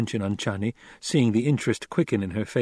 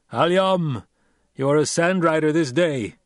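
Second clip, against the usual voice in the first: raised, firm voice of a stern desert chief; Stilgar, stern Fremen leader; 5 semitones higher, 4 dB louder than usual. A narrator's reading. Stilgar rebuking Paul after his sloppy ride, stern. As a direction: scold the young rider with authority.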